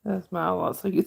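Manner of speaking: usual speaking voice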